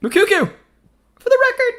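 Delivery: very high-pitched, thrilled match call; real high-pitched excitement; excited commentator cry. Real, not acted. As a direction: cry out as the chance comes. Live chance for a record goal.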